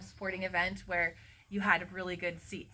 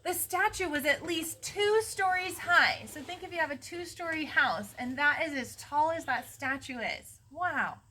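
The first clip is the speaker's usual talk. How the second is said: high, emphatic storybook wonder; dramatic read-aloud, louder and higher; awed story narration; 7 semitones higher, 4 dB louder than usual. A narrator's reading. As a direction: marvel aloud at an enormous sight. Awed narration of huge statue.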